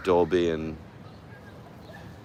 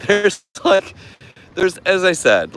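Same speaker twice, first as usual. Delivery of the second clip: laughing; 12 semitones higher, 8 dB louder than usual. Real, not acted. Laughing outburst.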